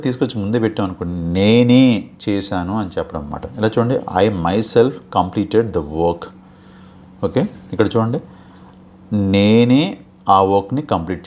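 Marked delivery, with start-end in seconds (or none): 1.4-1.8 నేనే drawn out and loud; loud, emphatic నేనే; emphatic stress. Shown as a demonstration. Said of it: "emphasize చేసి చెప్తాను". 9.3-9.8 నేనే louder, held long, rising; loud, drawn-out నేనే; emphatic stress. Shown as a demonstration. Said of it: "emphasize చేసి చెప్తాను".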